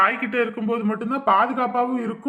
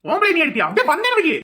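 higher, louder indignant scolding; rising anger at the mother, pitched up; angry outburst; 6 semitones higher, 3 dB louder than usual. Acted. Elder scolds mother for beating child. (குழந்தையை அடித்த தாயை மிரட்டும் பெரியவர், உயர்ந்த குரலில் கோபமாக)

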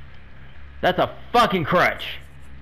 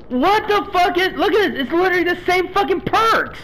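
exasperated outrage. Real, not acted. Streamer rages at identical survivor perks, high furious disbelief. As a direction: squeal it out in outrage.

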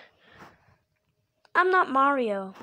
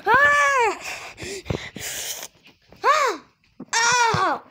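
high, repeated short screams; terrified screams; 9 semitones higher, much slower than usual. Acted. Orion's next target screams in terror. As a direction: scream in bursts under attack. Victim attacked.

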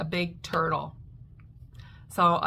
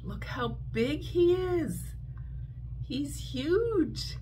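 amazed; 8 semitones higher, much slower than usual. Real, not acted. Marvels at big spider.

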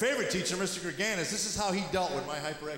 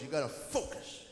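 hushed intense focus; 2 semitones lower, 6 dB quieter than usual. Retold or imitated. Teacher urging the boy to focus, hushed. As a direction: coach it in a hushed voice. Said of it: "He goes".

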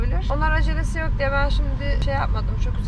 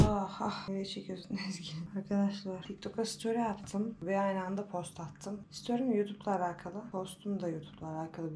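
soft whispered vlog talk, much softer; hushed whisper; 6 semitones lower, 13 dB quieter than usual. Real, not acted. Whispering near sleeping baby. (fısıltıyla, çok alçak sesle anlatım)

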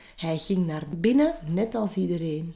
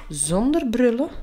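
plain reading voice

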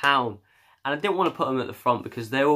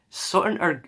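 higher, put-on Irish lilt; hammed-up Irish accent; 7 semitones higher than usual. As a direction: slip into a jokey Irish accent. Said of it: "I'm gonna stop doing the accent now".

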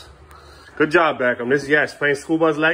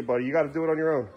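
ordinary delivery

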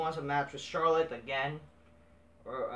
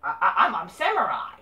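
mock confused wrestler, higher and louder; mocking impression; 5 semitones higher, 8 dB louder than usual. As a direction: parody her as dim and clueless, higher and louder. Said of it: "This is just fake, like".

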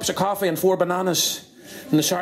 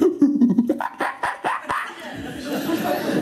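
high monkey hoots and screeches; hoot, then chatter like a monkey; 10 semitones higher than usual. Acted. Monkey up the bushes answering police, high chattering. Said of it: "and the monkey".

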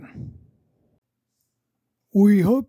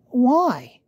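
little George voice; 8 semitones higher, 4 dB louder than usual. Acted.

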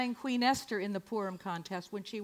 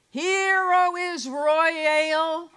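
very slow, loud, high chant of "Hear, O Israel"; rabbi intoning; 6 semitones higher, 8 dB louder, much slower than usual. Retold or imitated. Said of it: "rabbi who would say".

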